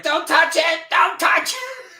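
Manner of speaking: frantic high scream. Retold or imitated. Strapped victim shrieking at her, overacted high scream. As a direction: scream it like a hammy actor losing his leg. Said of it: "he's like".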